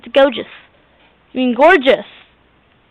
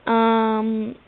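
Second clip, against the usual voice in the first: usual speaking voice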